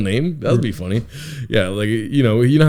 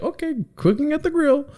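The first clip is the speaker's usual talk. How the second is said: much higher, suddenly cheerful and casual; breezy mom; 5 dB quieter than usual. Retold or imitated. Mom returning to the grill after kicking them out, breezy. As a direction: snap back to sunny calm. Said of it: "went back to being like".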